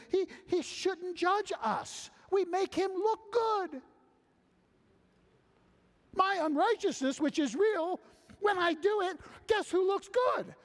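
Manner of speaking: very high, loud, mocking sinner's logic; smug objector. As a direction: gloat that your sin makes God look good. Preacher voicing smug objector.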